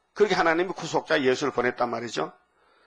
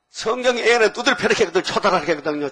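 loud, high pulpit declaration; fiery preaching; 6 semitones higher, 5 dB louder than usual. Real, not acted. Preacher scolding the congregation, loud and high. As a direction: drive the scripture home.

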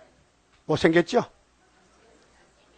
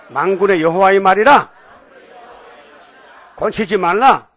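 call to recite; 4 dB louder than usual. Shown as a demonstration. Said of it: "그럼 따라합시다".

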